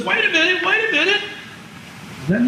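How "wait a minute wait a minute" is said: high, put-on cry of a puzzled viewer; mock moviegoer protest. Lecturer imagines a viewer interrupting the film, high mock protest. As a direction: play a baffled audience member stopping the film. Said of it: "nobody stands up and says".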